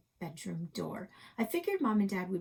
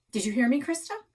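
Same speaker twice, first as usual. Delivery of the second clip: raised father; 4 semitones higher, 8 dB louder than usual. A narrator's reading. Father demands attention.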